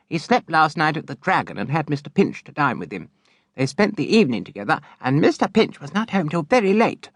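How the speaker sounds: high, quick telling-tales voice; tattling daughter. A narrator's reading. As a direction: report the gossip eagerly. Charity tells father.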